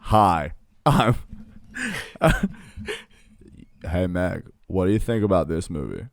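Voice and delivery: impression of a low-voiced shop man; Family Guy shop owner. Host retries his cartoon impression, slightly low character voice. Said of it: "I can do his voice really well".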